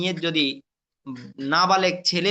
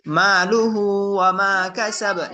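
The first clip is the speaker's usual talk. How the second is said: slow, measured tajweed chant; Quran recitation; 3 semitones higher, 3 dB louder, much slower than usual. Shown as a demonstration. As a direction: recite with drawn-out vowels. Recitation demo.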